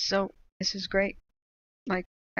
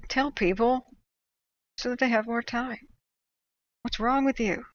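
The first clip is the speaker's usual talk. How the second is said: raised, higher exasperated scolding; exasperated rant; 4 semitones higher, 4 dB louder than usual. Real, not acted. Vlogger scolding fire agencies over late evacuation, exasperated and raised.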